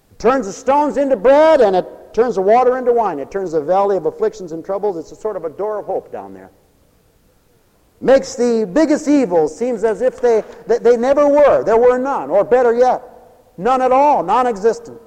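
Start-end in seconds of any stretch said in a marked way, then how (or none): 0.1-5.0 loud, raised proclamation; fervent preaching. Acted. Preacher proclaiming the Father's love, fervent preaching. 8.0-15.0 loud, high, insistent sermon voice; full-force preaching. Acted. Preacher declaring evils wiped away, full-force preaching.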